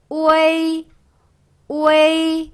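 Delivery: 오에이 blended on a high level tone; pronunciation demo. Shown as a demonstration. Teacher answering how it is read, pronunciation demo. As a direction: hold 오에이 long, keeping the e in the glide. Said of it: "이렇게 읽는다는 거".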